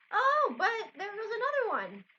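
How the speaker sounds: high, loud excited outburst; excited exclamation. A narrator's reading. Clarissa spotting another shooting star, excited. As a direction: burst out, pitch leaping up.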